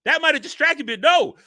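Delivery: high, loud mock outrage; hammed-up angry complaint; mock angry rant. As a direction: play the offended brute, yelling. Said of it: "make Peak Physical Man angry".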